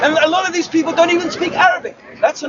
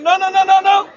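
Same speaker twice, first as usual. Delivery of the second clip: higher-pitched rapid-fire refusal; mock objection; 5 semitones higher than usual. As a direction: imitate a rival protesting. Voicing rival claimants objecting.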